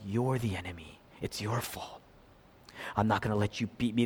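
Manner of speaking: soft, low, bitter inner voice; low resentful mutter. Retold or imitated. Preacher voices a resentful child's thoughts, low and soft.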